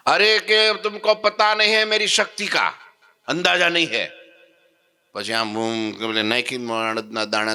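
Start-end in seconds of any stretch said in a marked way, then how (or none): 0.1-4.1 very high, loud, angry bragging; furious boast. Retold or imitated. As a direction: roar out his might. Madari boasts of his power.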